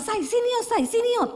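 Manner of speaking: husband excitedly calling his wife to the computer. Retold or imitated. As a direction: call her over, eager and high. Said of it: "Dia bilang".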